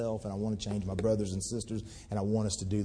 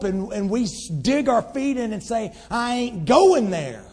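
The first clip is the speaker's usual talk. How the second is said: loud, high fiery sermon voice; full-force preaching; 8 semitones higher, 9 dB louder than usual. Acted. Pastor rousing his church about their neighbours, preaching loudly. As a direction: preach it hard to the whole room.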